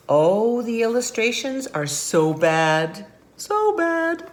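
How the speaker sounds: loud, drawn-out lament; exaggerated storybook horror; mock dismay. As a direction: bemoan it, climbing high on the repeat. Characters lament the ugly pictures.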